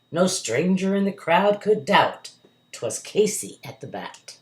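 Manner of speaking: plain reading voice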